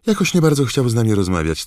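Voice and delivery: high, sly, prying tabloid reporter; gossipy journalist voice. Acted. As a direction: drip with nosy glee. Narrator voices gossip journalist Rita.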